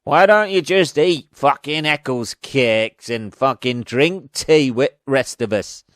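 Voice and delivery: hammed-up Northern antagonist; bad Northern accent. Antagonist nagging the character to be like everyone else, bad Northern accent. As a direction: do a terrible Northern accent, scornfully. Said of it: "Northern accent you've, uh, ever heard".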